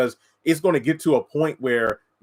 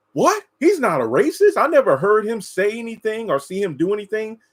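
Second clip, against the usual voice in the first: much higher, mock shocked disbelief; incredulous disbelief; 9 semitones higher, 3 dB louder than usual. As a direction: imitate a friend who can't believe it. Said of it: "they're like".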